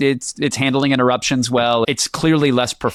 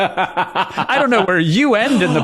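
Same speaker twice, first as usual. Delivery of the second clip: laughing; 6 semitones higher than usual. Real, not acted. Teasing co-host, laughing.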